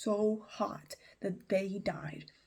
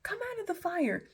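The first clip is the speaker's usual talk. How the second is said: calling out; 8 semitones higher than usual. Acted. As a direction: call to people inside a fire. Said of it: "he calls into the fire".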